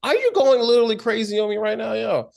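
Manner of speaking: high, fast, incredulous mock voice; comic imitation of a bewildered peacekeeper; quizzical lord. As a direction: stammer it out high and baffled. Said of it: "a quizzical person who's just trying to keep".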